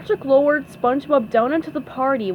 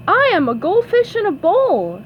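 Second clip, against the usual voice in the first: higher, louder party guest; lively rising and falling character voice; Sandy's voice; 10 semitones higher, 5 dB louder than usual. A narrator's reading. Sandy shows off her Halloween costume, lilting and high. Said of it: "said Sandy".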